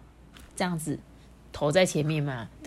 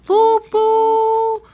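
much higher, loud playful toot-toot; train whistle toot; 11 dB louder than usual. Acted. Little engine tooting its whistle, much higher and loud.

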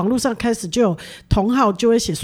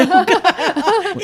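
laugh; 8 semitones higher than usual. Real, not acted. Shy admission, bursts out laughing.

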